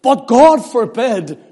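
God forbid exclaimed loud and slow. Shown as a demonstration. Preacher quoting Paul to the congregation, loud and exclaimed. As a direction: cry out "But God forbid" with full force. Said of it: "It's exclaimed".

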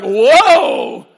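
loud slow horse whoa; pun on whoa. Pretend. Joking to the congregation, a loud slow whoa. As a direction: pull up the reins, whoa.

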